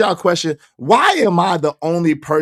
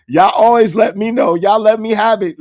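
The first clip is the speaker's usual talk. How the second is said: louder and higher, hammering ALWAYS; emphatic louder repeat; 6 semitones higher, 7 dB louder than usual. Real, not acted. Streamer telling his chat they always correct him, louder and emphatic. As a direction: push it out hard so chat hears.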